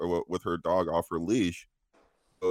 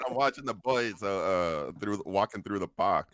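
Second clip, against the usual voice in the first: lightly put-on Boston accent; a hint of Boston twang; 4 semitones higher than usual. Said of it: "I turned into Boston at the end".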